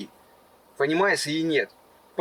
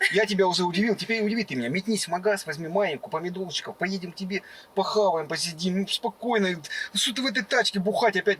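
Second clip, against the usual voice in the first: ordinary talk, nothing marked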